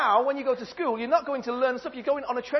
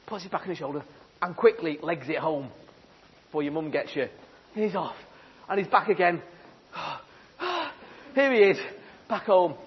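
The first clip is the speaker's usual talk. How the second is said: ordinary delivery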